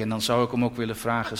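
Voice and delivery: normal talking voice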